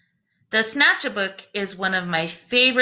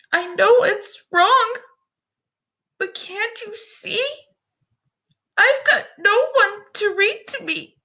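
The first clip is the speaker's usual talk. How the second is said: high, pleading little creature voice, softer on the question; a sad small creature begging through tears; crying little creature; 11 semitones higher than usual. Acted. The Snatch-a-Book pleads with Eliza, high and tearful. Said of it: "softly he began to cry".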